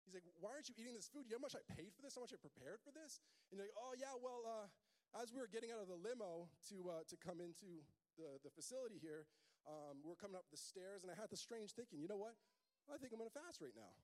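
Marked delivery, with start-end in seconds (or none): 0.4-3.2 high, put-out friend at the table; indignant buddy. Acted. Groom to friend refusing food, high and indignant. Buddy voiced in wedding story. 3.8-13.9 mock pious guest. Guest explains his fast.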